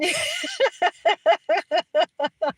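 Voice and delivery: high giggling fit; real laughter. Real, not acted. Streamer laughing at her fireplace joke, real laughter. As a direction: giggle hard at your own joke.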